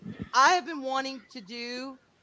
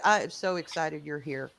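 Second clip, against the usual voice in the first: normal talking voice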